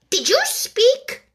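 high, slow, stiffly polite; icy politeness through a frown; frowning but polite. A narrator's reading. The offended mouse speaks to the Lory, stiffly polite. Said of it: "frowning, but very politely".

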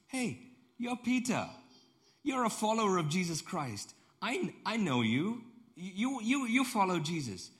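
single someone out excitedly; acted pointing-the-finger recognition, voice way up; loud accusation. Second accuser recognizes Peter, acted.

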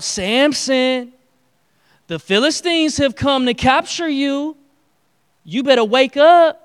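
loud alarmed call, loudest on wake up; Delilah crying out. Acted. Delilah warns Samson the Philistines have come, crying out. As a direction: shout the warning to rouse him. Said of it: "she cried out".

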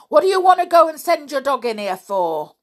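loud, high scolding; cross shout. A narrator's reading. Cross old man scolding Peter about the dog, angry. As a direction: scold him loudly.

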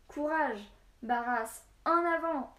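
loud, high rallying shout; urgent charging yell; yelled battle cry. A narrator's reading. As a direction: shout them onward into battle, each word punched. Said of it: "hurla encore Naquet".

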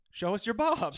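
high, loud pitch; mock announcer plea. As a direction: call it out to listeners.

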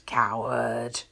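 low, mildly angry call; angry yell. A narrator's reading. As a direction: throw the insult out low and cross. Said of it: "Angered with this response, he screams".

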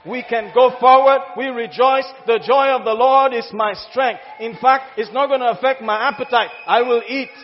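loud rising sermon cry; preaching at full force. Acted. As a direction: proclaim it to the congregation.

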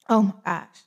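normal talking voice

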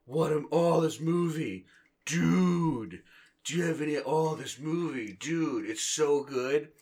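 loud, high, drawn-out gushing; hammed-up awestruck movie fan; gushing fanboy. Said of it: "I'm supposed to be like".

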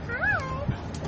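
very high cooing hi. Real, not acted. Greeting a baby.

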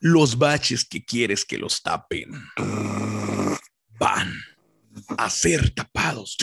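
slow, low announcer voice; dramatic campaign ad narration; political ad announcer. Retold or imitated. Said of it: "Y sale el vato acá".

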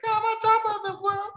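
very high squealing diva voice; mock diva. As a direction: squeal it like a reality-show diva. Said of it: "I just picture, like, total divas".

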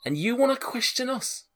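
high, aggrieved protest in a put-on accent; affronted, high-pitched character rant; indignant homeowner. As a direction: protest as if your home was invaded. Man defends his home to intruders.

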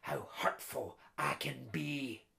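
low, soft menacing threat; a quiet villain's menace. Acted. Intruder threatens Mary.

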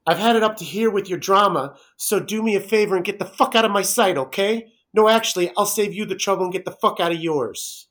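mock angry rant. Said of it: "next one".

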